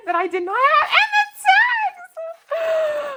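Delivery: high, loud, tearful squeal; overjoyed shriek. Real, not acted. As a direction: build from a laugh to a drawn-out shriek. Unboxing longed-for paper, overcome.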